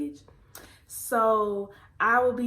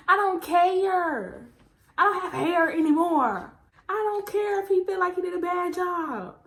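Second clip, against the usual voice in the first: upset retort; 8 semitones higher than usual. Retold or imitated. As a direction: vent at mom after crying. Upset retort to mom after crying.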